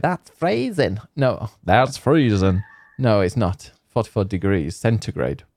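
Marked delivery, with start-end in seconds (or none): 0.0-1.0 higher, lightly voiced American shivering; mock American. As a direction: imitate an American balking at the cold. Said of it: "Americans will be like".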